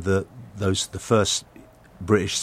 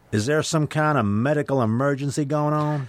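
put-on Southern patrolman accent; 6 semitones higher than usual. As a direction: play a big Texas trooper in his Southern accent. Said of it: "I'm not sure if I'm doing the accent right".

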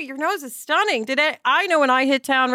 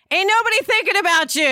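sassy; 8 semitones higher, 3 dB louder, faster than usual. Real, not acted. Sassy punchline to listeners.

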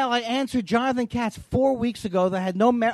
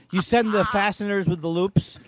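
normal talking voice

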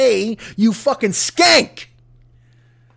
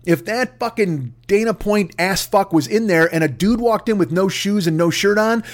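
normal talking voice

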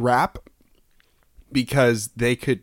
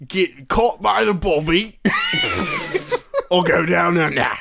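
mock English-Australian accent; 6 semitones higher than usual. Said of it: "I'm vacillating between two different accents".

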